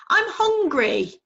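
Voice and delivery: high, slow little owl's whine; a small owl wanting food; owlet voice. Acted. Owlet whining to his sleeping father.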